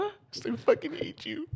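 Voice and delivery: playful fake outrage, high and drawn out; mock hate. Friend teasing after the scam joke, mock outrage. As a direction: pretend to be offended by the joke.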